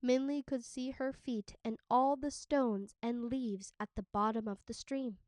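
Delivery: ordinary delivery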